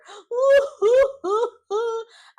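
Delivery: sharp high gasp of shock; loud gasp. Real, not acted. Vlogger reacting to Huda on the episode, loud shocked gasp.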